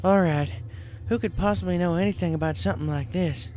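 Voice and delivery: country pony voice; Applejack voiced. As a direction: ask the group, stumped.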